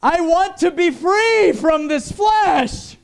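high, loud, slow shout for freedom; desperate cry. Acted. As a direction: cry out to be free of the flesh. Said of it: "your own spirit is saying".

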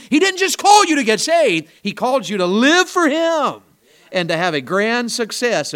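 ringing pulpit delivery; full-force preaching. Real, not acted. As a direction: preach it out to the back row.

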